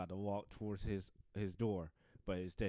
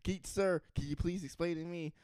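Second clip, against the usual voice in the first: higher, louder mock protest; protesting character; 9 semitones higher, 7 dB louder than usual. Comedian acts out someone asking sir to explain, protesting. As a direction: plead in a put-on higher voice.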